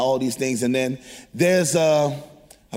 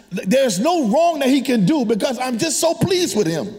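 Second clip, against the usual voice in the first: preaching; 6 semitones higher than usual. Acted. Preaching rises again.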